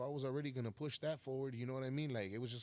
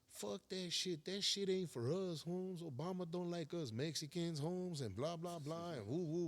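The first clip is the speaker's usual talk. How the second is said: higher, slower scoffing friend voice; mock friend scoffing, pitched up; dismissive homeboy; 6 semitones higher, slower than usual. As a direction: brush the idea off like a cynical cellmate. Friend voiced dismissing clemency idea.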